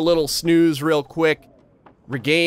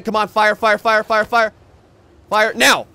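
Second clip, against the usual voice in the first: higher, louder urgent chant; frantic pleading; 6 semitones higher, 4 dB louder than usual. Real, not acted. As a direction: beg the fire to light. Wolf approaching, pleading fire.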